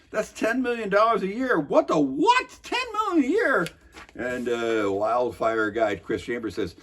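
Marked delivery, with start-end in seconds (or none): 0.1-3.7 incredulous mock outburst. Mocking a council member stunned by the cost, incredulous. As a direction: pitch shoots way up in disbelief.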